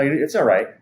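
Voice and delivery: ordinary talk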